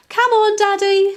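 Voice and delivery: high, loud eager call; bubbling over with excitement; a child begging to start; excited. Acted. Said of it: "said Daisy excitedly".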